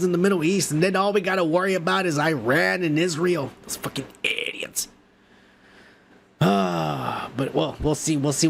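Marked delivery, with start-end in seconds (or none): 3.6-4.9 breathy whispered insult under the breath; whispered curse. Real, not acted. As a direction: hiss it under your breath.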